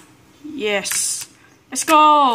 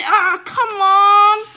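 slow, high, drawn-out whine; anguished wail; 6 semitones higher, 4 dB louder, much slower than usual. Real, not acted. Gamer losing a life, a slow high anguished wail. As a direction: wail at the game in despair. Gamer fails again, wails.

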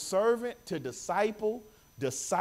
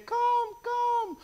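high beckoning call. Acted. God's voice beckoning the believer, high call. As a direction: call them gently but high.

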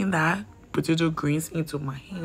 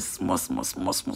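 whisper; 7 dB quieter, slower than usual. Shown as a demonstration. Mimicking fine slashing.

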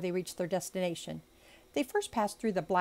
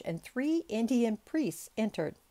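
ordinary delivery, nothing marked

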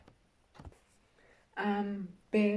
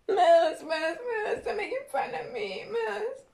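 high, teary whine calling Moose; whiny crying kid; 13 semitones higher, 7 dB louder, slower than usual. Acted. Teased girl crying to Moose, high whine. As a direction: wail it like a teased child near tears. Bullied kid calls for help.

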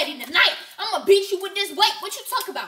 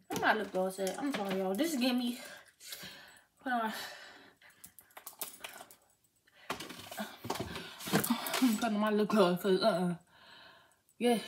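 low, soft, trying to stay calm; forced calm; 10 semitones lower, 12 dB quieter than usual. Real, not acted. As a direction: mutter to calm yourself down. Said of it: "my lip gloss is the only thing that can calm me down".

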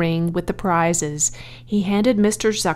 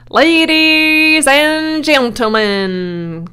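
loud, drawn-out public announcement; loudspeaker announcer; 8 semitones higher, 7 dB louder, much slower than usual. A narrator's reading. Loudspeaker greets the fair crowd, loud slow announcer. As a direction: stretch it out like a fairground announcer. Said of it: "continued the loudspeaker".

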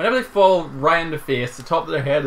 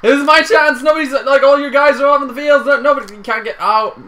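very high, loud, frantic excitement; excited yelling; 12 semitones higher, 10 dB louder than usual. Real, not acted. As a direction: yell it as your big chance opens up. Excited chance at a goal.

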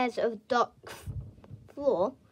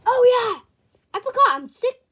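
excited; 7 semitones higher, 10 dB louder, faster than usual. Acted. Sudden realization.

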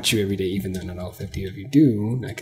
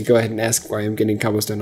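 ordinary talk, nothing marked

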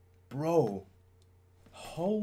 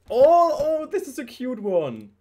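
excited squeal; 9 semitones higher, 10 dB louder than usual. Real, not acted. Finds a cute photo card.

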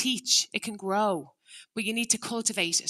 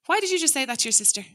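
accusing scold; 6 semitones higher, faster than usual. Shown as a demonstration. Parent scolding a child for words to the sister, accusing. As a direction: rattle off the reproach.

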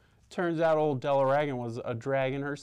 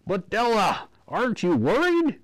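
high, louder, pressing doctor; worried doctor; 11 semitones higher, 6 dB louder than usual. A narrator's reading. Doctor pressing Della, high and worried. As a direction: push the worry on her. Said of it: "he carried on to say".

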